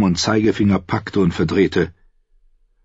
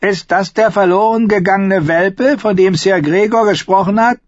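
mocking squire; 13 semitones higher, 5 dB louder than usual. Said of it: "fragte er den Kitzler".

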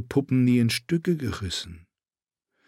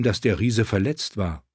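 ordinary delivery

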